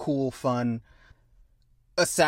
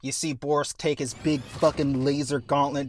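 ordinary talk, nothing marked

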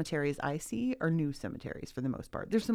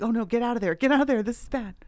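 mock alarm; 5 semitones higher, 9 dB louder than usual. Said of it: "I was like".

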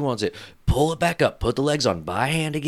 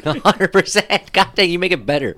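higher, laughing through the words; laughing tease; 8 semitones higher, 4 dB louder than usual. Real, not acted. Teasing co-host about his story, laughing and higher. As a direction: crack up while teasing. Joking about dirty talk.

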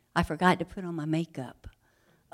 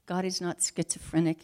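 ordinary talk, nothing marked

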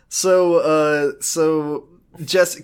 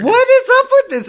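very high, loud squeal of outrage; mock outraged Josh; 8 dB louder than usual. Josh walking up to Declan, high loud mock outrage.

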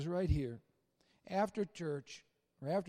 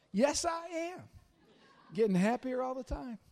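higher, bright proud reply; beaming yes; 4 semitones higher than usual. Retold or imitated. Preacher answering admirers, higher beaming reply. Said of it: "I'm like".

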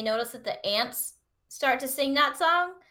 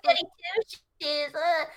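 mock airy voice; 7 semitones higher than usual. Mimicking Sandra Bullock line.

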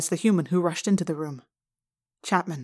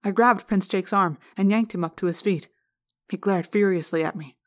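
plain reading voice

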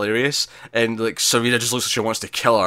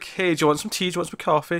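being nice; 6 semitones higher than usual. Retold or imitated. Aunt Lydia offering June tea, kinda nice. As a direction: imitate a sweetly solicitous offer in a raised voice. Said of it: "Lydia's kinda being nice".

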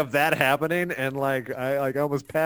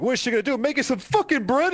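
raised-pitch incredulous mock rant; scornful father; 10 semitones higher, faster than usual. Father about to punch drywall, scornful outburst. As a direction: sneer it high in mock outrage. Angry father mocks baker's daughter.

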